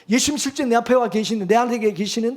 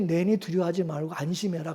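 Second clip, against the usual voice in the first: much softer, gentle and calm; soft reassuring Jesus; 5 semitones lower, 8 dB quieter than usual. Shown as a demonstration. Preacher voicing Jesus calming scared disciples, softly reassuring. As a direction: speak it like a quiet comfort to the frightened. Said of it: "마음이 평안해지겠죠".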